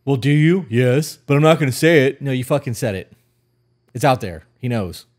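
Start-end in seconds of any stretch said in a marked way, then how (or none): 0.0-2.2 higher, louder, sing-song teasing imitation of the girlfriend; mock girlfriend voice. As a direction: playfully mimic her coy answer. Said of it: "You said".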